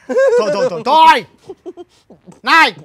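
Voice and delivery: loud, sharp bursts to cut off the giggling; high, loud scolding; exasperated shout. Acted. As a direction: shout to make the pupil stop. Scolding the giggling pupil to stop.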